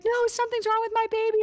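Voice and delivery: high, fast frantic plea; panicked. Retold or imitated. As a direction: rush it out like a scared new mom. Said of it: "I was freaking out".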